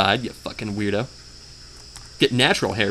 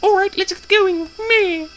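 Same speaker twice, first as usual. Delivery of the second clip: funny squeaky voice. Acted. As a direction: squeak it out like a cartoon. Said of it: "should be more of a manny voice".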